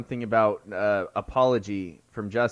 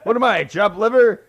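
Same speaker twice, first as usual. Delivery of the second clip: raised, loud dad-joke quip; old borscht belt comic; 9 semitones higher, 7 dB louder than usual. Shown as a demonstration. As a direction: toss it off like a corny old comic. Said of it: "You have to kinda say it like this".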